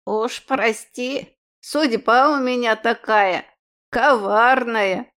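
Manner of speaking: put-on croaking old crone, slow; elderly breathless. A narrator's reading. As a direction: wheeze it like an old hag. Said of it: "прокряхтела женщина".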